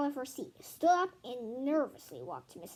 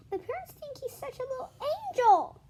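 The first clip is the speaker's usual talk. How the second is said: high, loud complaint; kid's high complaint; complaining; 12 semitones higher, 6 dB louder than usual. Acted. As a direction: grumble about the unfair parents. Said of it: "B B complained".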